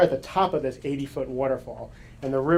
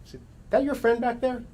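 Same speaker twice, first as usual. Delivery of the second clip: high, worried retold question; alarmed question; 9 semitones higher than usual. Narrator asking the passing girl about her friend, alarmed and high. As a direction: ask it with sudden alarm. Stranger arrives with horrified look.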